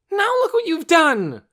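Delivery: high, loud furious scream. A narrator's reading. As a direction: scream it at her. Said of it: "Torin screamed".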